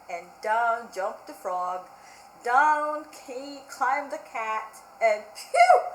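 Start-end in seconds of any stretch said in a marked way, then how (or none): 5.4-5.8 very high, loud relieved phew. Acted. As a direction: blow out a big sigh of relief. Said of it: "said the dog".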